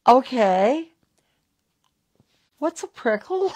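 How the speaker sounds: disbelieving what's a prickle; baffled surprise. Retold or imitated. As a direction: voice jumps high, puzzled. Retold baffled reply to spirit.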